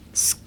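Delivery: a bare voiceless sk; whispered sk. Shown as a demonstration. Teacher isolates the sk sound. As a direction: hiss out sk with no vowel. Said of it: "スクール水着のスク".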